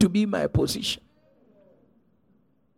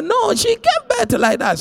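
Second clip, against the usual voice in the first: delighted answer; 5 dB louder, slower than usual. Retold or imitated.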